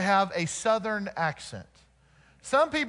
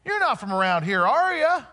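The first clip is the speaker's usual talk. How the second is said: higher, rising puzzled asking; curious local; 4 semitones higher than usual. Retold or imitated. People in Portland asking the preacher, a light mock puzzled voice. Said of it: "people would say constantly".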